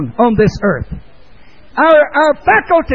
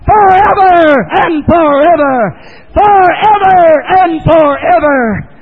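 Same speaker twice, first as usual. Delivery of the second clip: damning message; 6 semitones higher, 4 dB louder than usual. Acted. Hell's gates proclaiming to the soul, high shout. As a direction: cry it like a sentence from the gates. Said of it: "flares up ... with its damning message".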